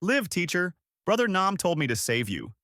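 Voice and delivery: high, insistent shout. A narrator's reading. Girl pulling her teacher ashore, high shout.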